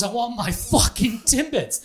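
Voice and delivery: high, slow irate customer impression; drawn-out retold tantrum over TIMBITS; imitated angry demand. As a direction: act out a customer berating a clerk. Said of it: "after he berates the poor girl".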